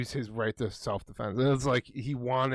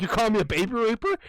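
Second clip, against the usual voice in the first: high, louder indignant imitation; mock outraged guard; 11 semitones higher, 6 dB louder than usual. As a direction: play the offended guard, pitched up. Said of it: "He's like".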